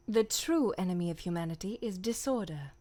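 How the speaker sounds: slightly low, restrained character voice; character impression. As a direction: deliver it as a lightly voiced game character. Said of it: "That's pretty much all I can do for her".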